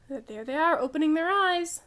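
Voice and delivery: plain reading voice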